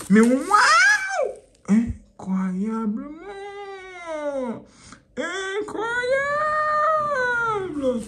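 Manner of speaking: high, slow stretched wow; hammed-up amazement. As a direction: drag out the wonder, hammed up.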